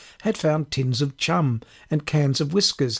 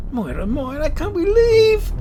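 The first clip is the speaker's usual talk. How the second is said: whining plea; 6 dB louder than usual. Acted. Desperate plea to bike.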